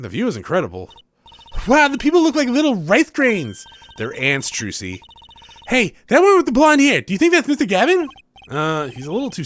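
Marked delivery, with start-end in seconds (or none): 1.7-3.6 put-on high, bright young magician girl; voicing the girl sidekick, perky and high; cheery girl voice. Voices Trucy in game dialogue. 5.7-8.1 put-on high, bright young magician girl; voicing the girl sidekick, perky and high; cheery girl voice. Voices Trucy in game dialogue.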